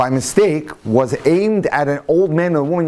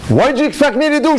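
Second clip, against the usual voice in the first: much higher and louder, an outraged comeback; indignant retort; 10 semitones higher, 3 dB louder, faster than usual. Retold or imitated. Woman snapping at her lawyer in indignation, higher and louder. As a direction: fire it back in disbelief, up high. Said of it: "she says".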